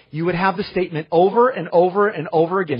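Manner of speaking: usual speaking voice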